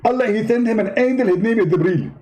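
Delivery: high, indignant preacher voice; Zakir Naik impression. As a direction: play the offended debater, pitched well up. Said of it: "Zakir Naik?".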